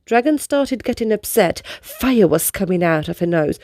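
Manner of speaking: plain reading voice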